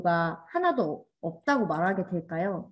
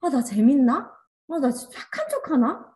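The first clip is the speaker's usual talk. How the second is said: higher, wondering voice to oneself; acted self-questioning; 3 semitones higher, 3 dB louder than usual. Teacher asking herself if she only acts nice, acted self-doubt. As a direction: question yourself in mock sudden doubt. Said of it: "나까지 어떻게 되더냐면".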